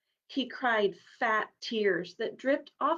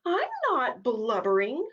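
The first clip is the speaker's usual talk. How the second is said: huffy denial, a touch higher; indignant; 4 semitones higher, 3 dB louder than usual. Acted. Rabbit denying to the horse he cried. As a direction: protest with wounded pride. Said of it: "said Rufus indignantly".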